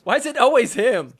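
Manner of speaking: hammed-up frustrated player; mock whining player. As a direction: complain to the heavens. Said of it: "He's like".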